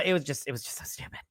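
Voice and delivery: ordinary talk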